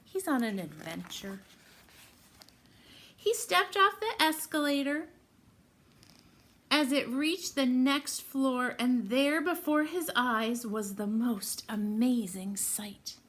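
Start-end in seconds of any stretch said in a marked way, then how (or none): 3.1-13.2 louder, suspenseful read-aloud; excited storytime narration. Acted. Teacher reading the escalator surprise to the class, excited. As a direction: build the surprise for the kids. Reads picture book to children.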